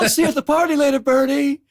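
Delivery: high, loud, quick holler; calling out. Retold or imitated. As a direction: imitate a passing boater shouting a greeting. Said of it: "you just hear people calling out".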